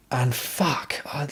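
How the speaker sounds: hushed, stunned curse; awed whisper. Real, not acted. To the viewers, remembering the car: awed whisper. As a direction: breathe it out unvoiced.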